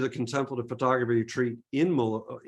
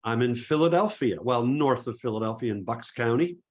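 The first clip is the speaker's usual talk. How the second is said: ordinary delivery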